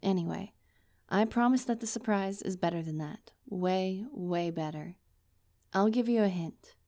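usual speaking voice

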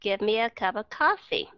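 connected American phrasing, short "cup"; no gaps between words, "cup of" linked; said quickly, linked. Shown as a demonstration. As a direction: run the words together as one phrase. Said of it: "họ nói rất là mau".

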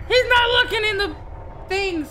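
high, loud nervous whisper-free chatter; tense excited. Real, not acted. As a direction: talk fast with nerves. Tense hiding commentary.

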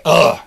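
loud, heavy mock groan; tired groan. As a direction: collapse into an exaggerated "ugh". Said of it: "we get tired".

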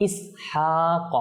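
ইসহাক slowly, with strong breathed h and long "haa"; correct throat h. Shown as a demonstration. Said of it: "সঠিকভাবে যেন উচ্চারণ হয়".

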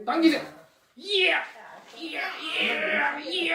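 high, bright showman hype; kids party magician. Acted. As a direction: rev up the kids like a stage magician. Magician hyping the rope trick.

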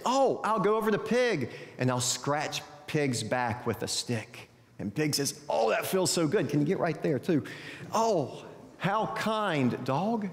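animated storyteller with swooping pitch; children's story narration. Acted. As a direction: read it like a picture book to young children, voicing the animals. Tells a children's story aloud.

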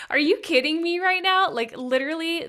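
high, loud, stunned outcry; gasping disbelief through tears; shocked, teary outburst. Real, not acted. Host overwhelmed after the chant, crying out in surprise. Trying not to cry right now.

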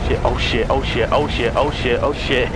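low, rapid nervous chant of oh shit; nervous muttering. Real, not acted. Nervous before boss fight in game.

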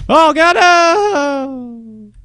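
long, high, drawn-out wail; loud anguished gamer howl; agonized scream. Real, not acted. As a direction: scream it out slowly in despair. Fails badly in game.

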